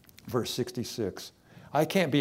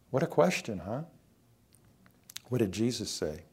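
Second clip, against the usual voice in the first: ordinary talk, nothing marked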